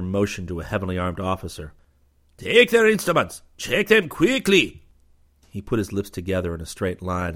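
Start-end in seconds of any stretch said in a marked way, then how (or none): 2.4-4.8 loud, higher, slow commands; barked orders. A narrator's reading. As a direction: bark the orders at the officer.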